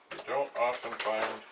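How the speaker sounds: ordinary talk, nothing marked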